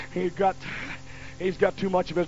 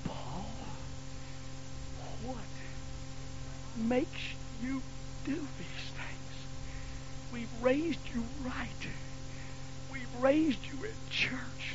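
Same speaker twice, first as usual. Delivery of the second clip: soft, slow, teary plea; a hurt mother scolding her son; mother crying; 5 semitones higher, 16 dB quieter, slower than usual. Retold or imitated. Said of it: "she was crying and serious".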